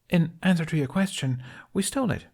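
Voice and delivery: high, fast, chipper woman; frantic upbeat. A narrator's reading. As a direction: rush the words out excitedly. Tooth answers Jack.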